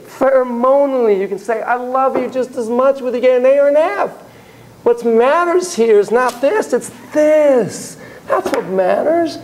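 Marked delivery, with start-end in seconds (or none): 1.6-9.4 impassioned, pitched-up plea; emotional. Real, not acted. Teacher voicing a parent's love whatever the grade, emotional. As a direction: press it home with real feeling, voice lifted. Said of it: "am I getting emotional".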